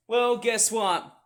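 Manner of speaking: ordinary talk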